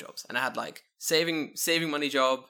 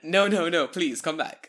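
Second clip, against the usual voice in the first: louder, higher hammed-up begging; mock pleading boss; 5 semitones higher, 8 dB louder, slower than usual. The manager begging the fired worker to come back, hammed-up. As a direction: beg him back in a silly panicked boss voice. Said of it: "it's like".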